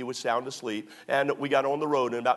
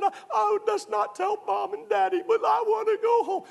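much higher, tearful pleading bride; wife crying. Retold or imitated. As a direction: plead through tears like the upset wife. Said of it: "she said".

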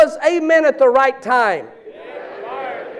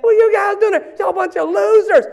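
much higher taunting shout; mocking heckle; 9 semitones higher, faster than usual. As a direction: jeer at the rival fans. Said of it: "pointed at".

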